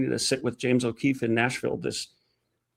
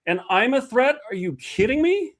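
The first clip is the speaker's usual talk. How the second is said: indignant outrage; 8 semitones higher than usual. Real, not acted. Repeats her outraged point.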